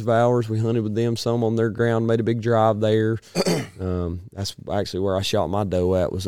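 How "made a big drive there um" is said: ordinary talk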